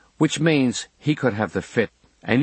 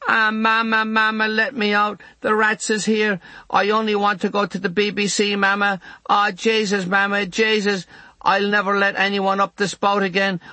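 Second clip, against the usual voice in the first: put-on much higher, pleading boy's Limerick wail; crying in a Limerick accent; 10 semitones higher than usual. A narrator's reading. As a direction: beg Mama through tears, in pure Limerick. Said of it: "He's crying and calling to her in a pure Limerick accent".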